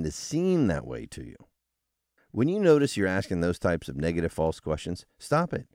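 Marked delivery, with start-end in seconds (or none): none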